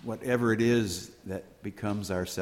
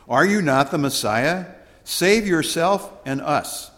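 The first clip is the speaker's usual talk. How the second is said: criminal's taunt; 5 semitones higher, 6 dB louder than usual. Acted. Criminal on the cross deriding Jesus, mild mock scorn. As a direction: throw the taunt up at him.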